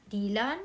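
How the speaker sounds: Dylan said DEE-lahn, first vowel as in "see"; Dylan as "DEE-lan". Shown as a demonstration. Reader settling on her own language's pronunciation, Dylan said DEE-lan. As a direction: give Dylan a long "ee" and a rising end. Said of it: "I will just pronounce it as".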